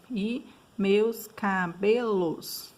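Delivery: "e meus cabelos" very slow, each syllable drawn out separately. Shown as a demonstration. Teacher showing students how to separate syllables, slow syllable-by-syllable demo. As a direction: split "cabelos" into ca, be, los, stretching each. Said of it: "Separando as sílabas".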